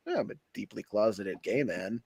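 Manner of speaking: low, quiet deadpan; lightly imitating Norm Macdonald; Norm Macdonald impression. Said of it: "that's a Norm Macdonald bit".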